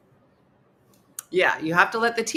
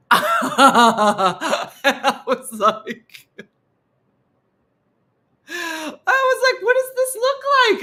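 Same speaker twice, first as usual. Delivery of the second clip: high, loud laugh-cry; laughing wail; 9 semitones higher, 9 dB louder, much slower than usual. Real, not acted. Narrator cracking up retelling, laughing wail. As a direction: crack up while shrieking.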